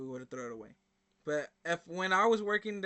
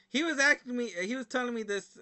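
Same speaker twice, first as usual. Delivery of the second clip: ordinary delivery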